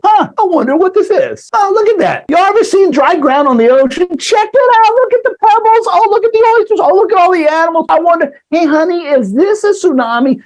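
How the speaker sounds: very high, giddy sightseer voice; oblivious beachgoer. Acted. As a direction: marvel in a high-pitched voice, clueless to danger. Acting out an oblivious beachgoer at tsunami.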